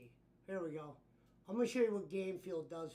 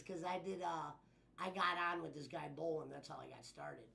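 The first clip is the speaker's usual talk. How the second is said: ordinary talk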